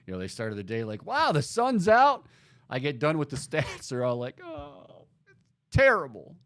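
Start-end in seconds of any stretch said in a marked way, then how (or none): 1.1-2.3 high, slow, mock-wonder exclamation; mock delight. Host mimicking listeners before he reads the stats, mock delighted. 4.4-6.4 hammed-up dismay, imitating upset listeners; mock crying. Host mimicking listeners after the bad stats, mock wailing. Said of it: "people are crying right now".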